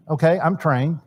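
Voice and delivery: ordinary talk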